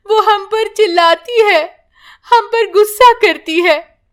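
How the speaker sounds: put-on aggrieved old woman voice; complaining grandmother. Grandmother complains about daughter-in-law.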